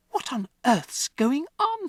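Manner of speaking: put-on high, slow, alarmed girl's voice; a child bursting in on chaos; little girl crying out. Matilda to her parents, high alarmed cry. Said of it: "she cried".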